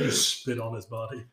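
ordinary delivery

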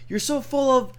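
high, loud mock tirade; ripping into him. Telling off the prank caller, high and loud. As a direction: tear into the caller. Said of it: "just kinda ripping into the guy".

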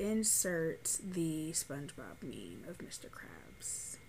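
soft whisper, slow; whispered aside. Real, not acted. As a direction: drop to a whisper for the editor. Editing aside to future self.

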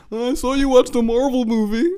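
high, mocking voice of the company; mock corporate voice. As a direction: sneer it like a snooping provider. Mock email from internet provider.

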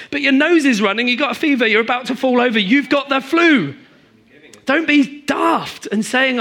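loud, high exasperated rebuttal. Real, not acted. Preacher scoffing at people denying their flu, loud and exasperated. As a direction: pile up the symptoms in disbelief.

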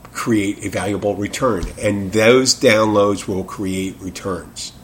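ordinary talk

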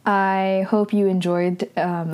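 ordinary talk

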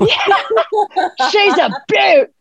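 high, stretched Australian catchphrase; Steve Irwin impression. Guest plays Irwin in the studio, Steve Irwin impression. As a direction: crow it slowly in broad Australian. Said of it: "we didn't really have Steve Irwin".

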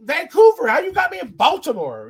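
high, loud, hammed-up alibi; indignant mock protest. Accused man giving his alibi, in high mock indignation. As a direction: plead the alibi in a squealing voice.

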